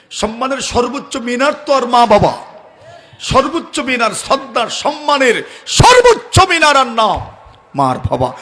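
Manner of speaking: fiery preacher hitting the climax; rising preaching shout. Real, not acted. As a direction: climb into an impassioned shout. Preacher driving his point home.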